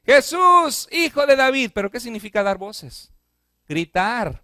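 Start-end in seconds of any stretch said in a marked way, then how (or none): 0.1-1.7 high, loud desperate call; a blind man calling out to Jesus, loud and high; crying out. Acted. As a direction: shout it across the crowd. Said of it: "Vino un hombre dando voces a Jesús".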